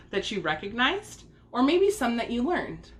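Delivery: ordinary delivery, nothing marked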